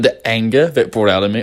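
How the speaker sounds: ordinary delivery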